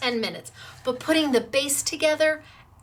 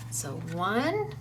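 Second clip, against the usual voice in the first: normal talking voice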